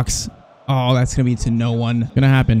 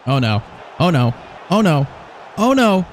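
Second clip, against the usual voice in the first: mounting alarm. Real, not acted. Danger builds near own goal.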